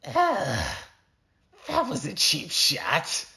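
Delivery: high, slow villain voice in pain; knocked-out assassin grumbles at the cowboy. Acted. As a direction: wince and complain like a sore loser. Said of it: "Silent said".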